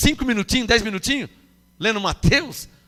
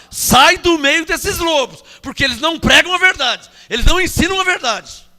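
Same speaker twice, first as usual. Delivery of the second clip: voice of God; 6 semitones higher, 4 dB louder than usual. Acted. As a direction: command them out of the wolves' den. God's voice quoted.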